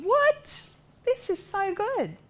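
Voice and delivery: high, slow what, then so good, thrilled; a retold rush of joy; delighted disbelief. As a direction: squeal it out in happy disbelief. Her own delighted reaction, retold.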